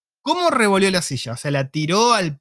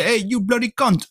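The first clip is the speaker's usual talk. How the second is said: higher, drawn-out playful insult; mock insulting English; 5 semitones higher, slower than usual. Listener jokingly guesses what waifu was told, mock-abusive. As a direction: act out a slow, raised-pitch taunt in English. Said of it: "si lo estabas diciendo de manera informal, formal, bardeando".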